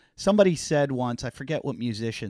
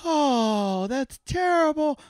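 mock disgusted crowd; 13 semitones higher, much slower than usual. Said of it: "They were like".

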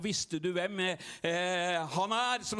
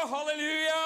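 halleluja, high and loud; shouted halleluja; 9 semitones higher, 4 dB louder than usual. Real, not acted. Pastor cheering the praying crowd, loud shout. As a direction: let the halleluja ring out. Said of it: "bare skru meg ned".

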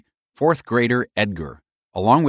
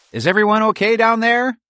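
high squirrel voice calling down from a tree; 7 dB louder than usual. A narrator's reading. Bob the squirrel calls down from the walnut tree, high and loud. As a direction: call down from the treetop, high and loud. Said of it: "Bob the squirrel called".

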